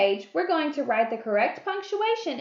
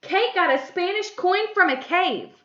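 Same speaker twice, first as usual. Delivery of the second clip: loud, high, excited reading; exclamation; 6 semitones higher, 5 dB louder than usual. Shown as a demonstration. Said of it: "This was an exclamation".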